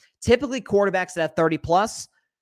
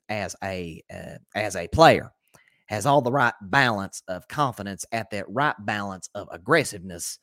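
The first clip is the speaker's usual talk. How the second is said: put-on low, slow drawled accent of a team owner; Jerry Jones; 7 semitones lower than usual. Host quotes the team owner in his accent, slow low drawl. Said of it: "let me say that in Jerry's accent".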